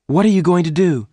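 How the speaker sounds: high, loud and fast alarmed cry. A narrator's reading. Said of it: "Jane cried".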